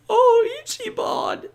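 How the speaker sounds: very high, slow, moved falsetto; hammed-up fan melting over the hero; mock swooning sigh. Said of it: "And it's like".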